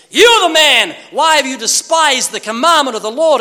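loud, high accusing cry; prophet's rebuke. Retold or imitated. Nathan confronts King David in a loud rebuke. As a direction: denounce him at full voice. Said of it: "And Nathan said".

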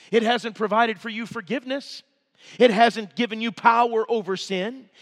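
ordinary talk, nothing marked

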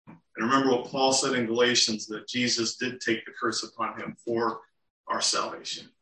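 ordinary talk

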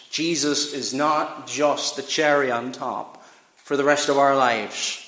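normal talking voice

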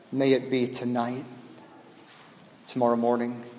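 softly spoken sermon appeal; hushed preaching. Acted. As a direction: draw them in quietly. Quiet reflective preaching.

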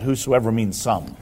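"whosoever means some" dropped low and soft, dismissive; mocking whosoever means some. Shown as a demonstration. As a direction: sneer it quietly like a pedant. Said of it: "somebody can tell them that".

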